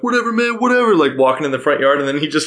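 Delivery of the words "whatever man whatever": high-pitched mock bravado, brushing it off; dismissive guys. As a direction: imitate cocky guys in a higher voice. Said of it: "the guys coming up, like".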